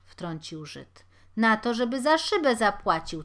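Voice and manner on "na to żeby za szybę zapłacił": loud insistent demand. Acted. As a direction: demand he pays up.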